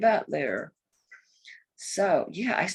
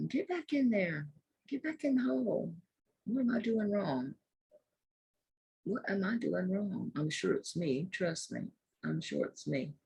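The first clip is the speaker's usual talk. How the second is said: soft, absorbed self-talk; hushed, private grumbling at a small task; muttering to oneself; 9 dB quieter than usual. Real, not acted. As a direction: murmur under your breath while fiddling with a clasp. Talking to herself fiddling with clasp.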